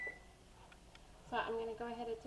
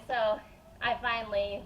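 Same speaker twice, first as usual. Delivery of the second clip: ordinary delivery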